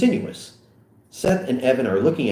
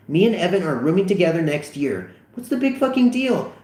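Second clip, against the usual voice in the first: higher, defensive whine; defensive teen voice; 8 semitones higher than usual. Acted. Vogel defending the rooming plan, higher and defensive. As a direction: brush it off as no big deal.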